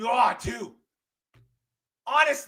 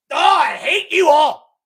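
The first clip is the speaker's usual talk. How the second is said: slow, loud shouted hate; furious outburst; 5 semitones higher, 11 dB louder, slower than usual. Real, not acted. Yelling at Saints fans on his stream, furious. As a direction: explode at them.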